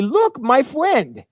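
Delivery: put-on high, slow, delighted child voice; excited toddler. A narrator's reading. Two-year-old proudly showing dad the rattlesnake, slow excited child voice. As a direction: show off your new friend with glee. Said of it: "he pointed excitedly".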